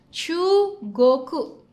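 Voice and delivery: Chugoku drawn out slowly, chu-go-ku; slow model. Shown as a demonstration. Teacher stretching Chugoku in the review drill. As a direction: let them hear each sound of Chugoku.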